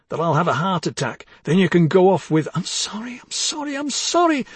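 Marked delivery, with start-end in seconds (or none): 2.5-4.5 high, whining mimicry of apologies; sneering imitation of wife; mocking parrot cry. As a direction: mimic her pleading, rising higher each time. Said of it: "that parrot cry".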